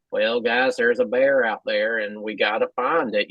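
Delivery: low, deadpan imitation of an actor; dry, calm drawl. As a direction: imitate a dry, unruffled detective, pitched low. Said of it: "It's just his dry voice".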